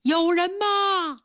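high, slow call into the dark; teacher calls into a flooded room, high and slow. A narrator's reading. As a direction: call out, drawing the words out. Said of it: "他只有大喊".

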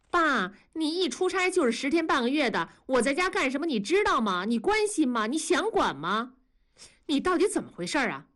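high, fast string of accusations; resentful daughter. A narrator's reading. Daughter throws her father's question back, high and fast. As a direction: pelt him with bitter questions.

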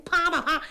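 arrows twanging. A narrator's reading. Arrows loosing, onomatopoeia.

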